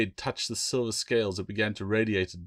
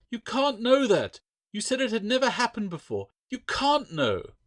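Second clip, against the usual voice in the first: upset Claire; 4 dB louder than usual. A narrator's reading. Claire refusing Bill's bleak verdict, high and desperate. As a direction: refuse to accept it, pleading.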